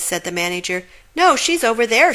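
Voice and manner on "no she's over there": higher, louder call. Acted. Witch calling out during the chase, higher and louder. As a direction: point the others the other way. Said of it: "said a witch".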